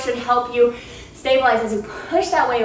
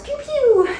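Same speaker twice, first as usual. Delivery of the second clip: pew, pew pitched way up like a laser gun; Star Wars blaster noise; 12 semitones higher than usual. Shown as a demonstration. Instructor to class, playful high Star Wars blaster pew. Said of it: "makes me think of Star Wars".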